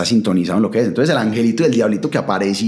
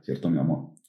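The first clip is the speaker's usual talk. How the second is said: soft, lower, slowed lover's voice; soft deeper voice; 7 semitones lower, 9 dB quieter than usual. Shown as a demonstration. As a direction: coo it low like talking to your partner. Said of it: "pone la voz más gruesa".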